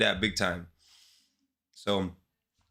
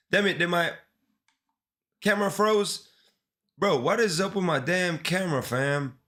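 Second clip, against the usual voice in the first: frustrated; 7 semitones higher than usual. Real, not acted. Camera freezes mid-stream.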